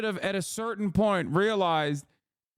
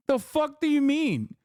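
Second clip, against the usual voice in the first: outraged; 8 semitones higher than usual. Real, not acted. Outraged rant at Elon.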